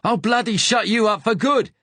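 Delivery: very high, loud Cockney threat; furious Cockney threat. A narrator's reading. Gunman threatening to silence wounded Ben for good, furious Cockney shout.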